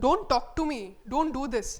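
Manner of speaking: serious order with Don't stressed each time. Shown as a demonstration. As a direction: hit every Don't hard and serious. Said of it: "don't కి stress వేస్తున్నాం".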